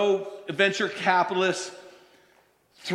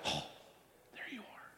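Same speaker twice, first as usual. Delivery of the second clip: whisper; 3 semitones higher, 21 dB quieter than usual. Acted. Quiet discovery of God.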